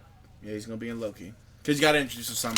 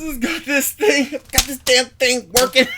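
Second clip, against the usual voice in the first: high, slow, loud exasperated whine; hammed-up griping boss voice; exasperated Fury impression; 10 semitones higher, 9 dB louder, slower than usual. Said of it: "And he said".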